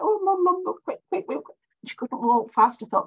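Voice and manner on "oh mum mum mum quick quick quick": much higher, frantic hurrying; mock panic. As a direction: flap and rush her out.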